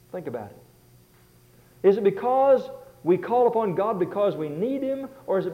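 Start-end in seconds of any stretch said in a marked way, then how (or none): 0.1-1.9 breathy whisper, slow and hushed. Real, not acted. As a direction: lean in and whisper the point. Preacher drops to a whisper.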